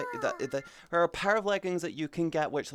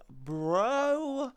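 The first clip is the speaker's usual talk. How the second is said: incredulous; 11 semitones higher, 4 dB louder, much slower than usual. Real, not acted. Incredulous remark.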